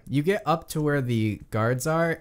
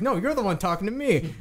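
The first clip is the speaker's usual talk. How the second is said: bickering orc, higher; 9 semitones higher than usual. Acted. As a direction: pitch jumps up in a squabble. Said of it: "the other one's like".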